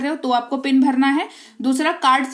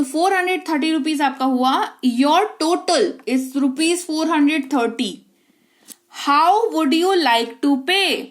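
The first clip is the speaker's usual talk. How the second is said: slow, careful English lines for learners; slow teaching demo; 3 semitones higher, much slower than usual. Shown as a demonstration. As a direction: speak each word slowly so they follow. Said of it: "मैं धीरे बोल रही हूँ". (धीरे धीरे समझाकर बोलना)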